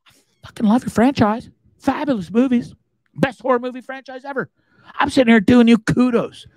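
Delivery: exasperated protest. Retold or imitated. Host defending his praise to franchise, high exasperated pleading. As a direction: plead your case in mock exasperation.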